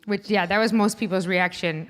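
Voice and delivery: usual speaking voice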